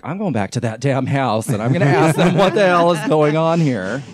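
building to a loud, high outburst; acted indignation. Teller vows to go back and question the house, acted indignation. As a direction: work up to a comic, indignant demand.

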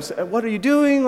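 high-pitched frazzled "what are you doing"; a hint of an anxious new dog owner losing it; mock exasperated at the dog. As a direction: snap at the dog, half-playing your panic.

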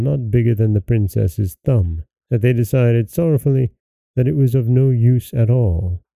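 ordinary delivery, nothing marked

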